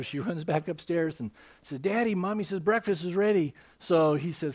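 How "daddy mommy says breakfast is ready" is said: put-on little girl calling. Daughter repeats message to father.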